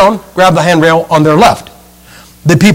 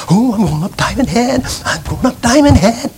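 mock-eager, softer sing-song chant; eager hikers; 5 semitones higher, 5 dB quieter than usual. Preacher imitates eager tourists starting the climb, mock-excited chant. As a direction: bounce it like giddy tourists heading up. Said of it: "So they're excited about going up Diamond Head".

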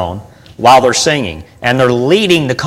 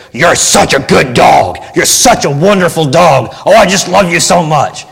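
harsh tone to dog; 4 semitones higher, 3 dB louder than usual. Shown as a demonstration. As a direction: yell sweet words in a harsh, mean tone. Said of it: "if you walk up to him and say".

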